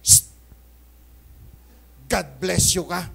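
a hushing "Shh", then a loud syrupy "God bless you ka"; calming an angry spouse with a sugary blessing; shushing sweet blessing. Shown as a demonstration. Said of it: "Sasagot daw ako ng ganito".

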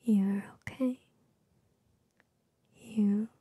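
ordinary talk

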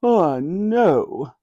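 a touch of royal amusement; chuckling Queen. A narrator's reading. Queen amused at Ben's surprise, lightly chuckling. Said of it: "the Queen chuckled".

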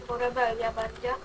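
ordinary talk

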